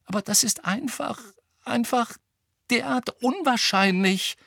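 high-pitched, faltering plea; desperate. A narrator's reading. Hermine protests how unlikely it is, desperately. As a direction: halt between phrases as if at a loss. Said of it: "sagte Hermine verzweifelt".

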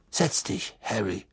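soft, low-key request to sit; hushed invitation. A narrator's reading. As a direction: offer a seat in a near murmur. Minister quietly seats the boy.

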